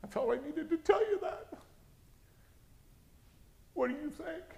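very high, tight mother voice; strained high pitch, tentative asking; tearful-style confiding. Retold or imitated. Mother telling her child the dream, high and strained.

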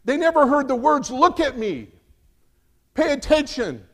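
preaching intensity. Acted. Preaching at full force.

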